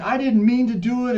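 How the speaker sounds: high, defensive angry protest; mad outburst. Retold or imitated. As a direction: imitate blowing up while making excuses. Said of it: "you're just mad".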